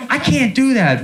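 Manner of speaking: usual speaking voice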